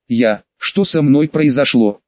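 ordinary delivery

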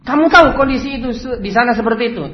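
high, loud accusing question; acted outburst of an angry employer; angry boss. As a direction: scold the worker in disbelief. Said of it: "Akhirnya dia marah-marah".

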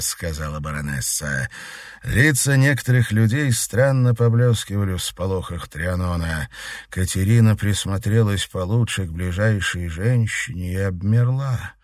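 plain reading voice

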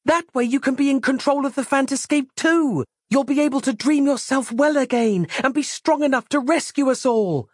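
high-pitched, urgent pleading; desperate. A narrator's reading. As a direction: keep pleading, voice held high. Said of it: "the desperation in her own voice".

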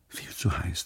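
whispered 'Viel zu heiß', breath only. Acted. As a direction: let the thought slip out as a whisper. Inner thought, drowsy from wine. (geflüstert, nur Atem, schläfrig)

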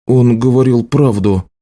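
usual speaking voice

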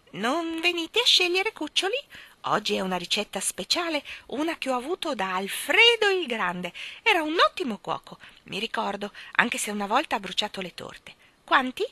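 high, lively crone voice, friendly; sweet old Mrs Corey. Acted. Old shopkeeper invites children to pick gingerbread. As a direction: coax the children kindly.

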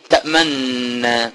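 slow تأمنا, lips silently rounded on the nun; ishmam demo. Shown as a demonstration. Teacher showing ishmam slowly and quietly. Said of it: "ما ضمتش بقي".

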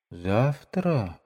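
soft, hesitant, drawn-out question; drawn-out and thoughtful. Acted. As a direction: linger on it, undecided. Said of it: "протяжно и задумчиво сказала Лизавета".

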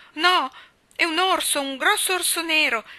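put-on very high, loud, terrified child's scream; frightened shriek. A narrator's reading. Rob runs back to Nan, screaming in fear of a bear. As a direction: shriek it in panic as you run back. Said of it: "strillando tutto spaventato".

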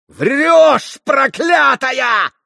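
high, drawn-out enraged scream; furious yell. A narrator's reading. Mitya accusing the old servant of lying, furious yell. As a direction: roar the accusation at full force. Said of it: "завопил Митя".

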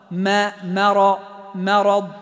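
مرض slow, mim thin before heavy ra; light mim. Shown as a demonstration. Teacher drilling مرض for students, slow. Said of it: "فاحرص على ترقيق الميم".

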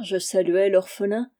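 ordinary delivery, nothing marked

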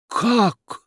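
high, sudden gasped question; astonished gasp. A narrator's reading. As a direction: gasp it out in amazement. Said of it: "ахнул Федяшев". (изумлённо ахнуть)

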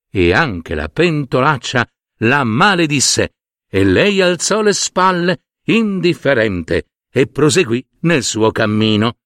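usual speaking voice

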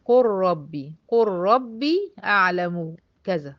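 slow قل ربي as "qur rabbi"; measured Quranic recitation with the merge; idgham of lam into ra. Shown as a demonstration. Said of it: "حصل ادغام".

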